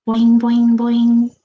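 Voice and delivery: loud springy boings; evenly pitched cartoon spring noise; bouncing boing. Shown as a demonstration. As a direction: bounce each one out like a hopping rabbit. Imitates a rabbit hopping.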